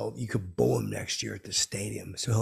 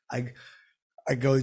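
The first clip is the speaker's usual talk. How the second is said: normal talking voice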